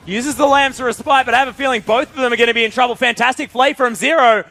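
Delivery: high, loud esports shoutcast; excited play-by-play. Real, not acted. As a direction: belt out the fight as it breaks. Live play-by-play at full pitch.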